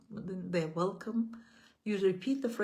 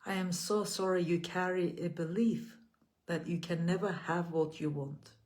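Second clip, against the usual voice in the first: measured, gentle affirmations with long pauses between. Acted. Leads repeat-after-me affirmations.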